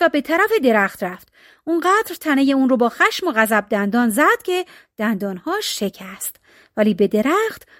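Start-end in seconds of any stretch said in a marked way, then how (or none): none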